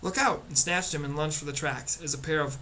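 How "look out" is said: high, urgent cry of alarm; yelled warning. A narrator's reading. Girl warns boy of falling trash bags, yelling. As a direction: call out a sudden warning, pitched well up. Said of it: "suddenly Primrose yelled".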